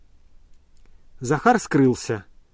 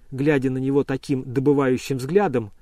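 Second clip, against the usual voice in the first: ordinary delivery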